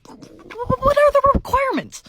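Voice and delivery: high drawn-out plea; pleading, squeaky, slow; high, slow whiny plea. Real, not acted. Exasperated rhetorical question.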